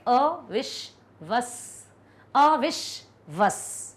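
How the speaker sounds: अविश्वस drawn out syllable by syllable; slow syllable demo. Shown as a demonstration. Teacher to class, spelling out the sounds slowly. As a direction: stretch out अविश्वस slowly, part by part. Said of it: "तो हम क्या कहेंगे".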